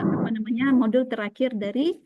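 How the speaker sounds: usual speaking voice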